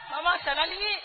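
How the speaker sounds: usual speaking voice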